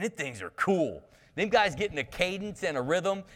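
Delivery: ordinary talk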